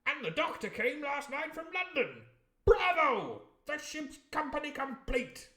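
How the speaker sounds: very high, loud, blustering squire; the squire boasting his crew is complete, high and loud; jolly squire. Acted. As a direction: crow it like a self-pleased gentleman. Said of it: "He cried".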